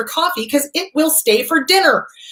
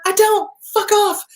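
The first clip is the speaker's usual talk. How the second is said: high defiant retort; 11 semitones higher than usual. Pretend. Talking back to inner voice.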